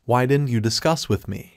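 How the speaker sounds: plain reading voice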